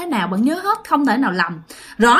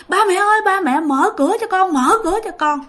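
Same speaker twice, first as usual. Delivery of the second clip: higher, panicked cry for mom and dad; frightened pleading; 8 semitones higher than usual. Retold or imitated. Terrified child banging on the door, begging parents to let her in. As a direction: beg them to open the door, scared.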